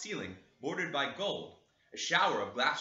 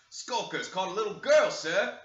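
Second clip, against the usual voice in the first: loud, higher servant's voice; servant reporting; 6 semitones higher, 8 dB louder than usual. Acted. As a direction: report it eagerly to the master. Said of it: "he replied".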